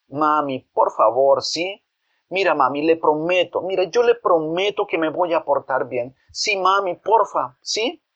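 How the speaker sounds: put-on high, wheedling child voice rising to a squeaky ¿sí?; begging boy. Boy begs his hesitant mother with promises, wheedling. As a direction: beg sweetly, promising to behave.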